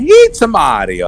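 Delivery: Mario voice. Retold or imitated. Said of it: "drop that Mario in a hat".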